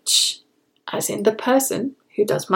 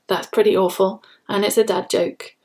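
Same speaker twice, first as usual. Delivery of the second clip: usual speaking voice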